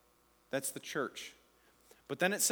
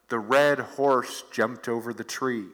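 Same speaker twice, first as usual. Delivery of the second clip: loud, slow, low put-on voice; garbled telephone-game message; 5 semitones lower, 8 dB louder, slower than usual. Last player in the telephone game, loud slow mock whisper. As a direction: announce it big and drawn out, pitched down. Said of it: "by the other end, you have".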